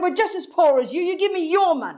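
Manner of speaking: high, scolding comeback; cross mum demanding his money; indignant retort. Retold or imitated. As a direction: turn the demand back on him. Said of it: "I said".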